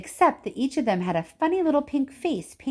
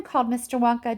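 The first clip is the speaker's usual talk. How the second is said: ordinary delivery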